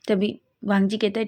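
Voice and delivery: ordinary delivery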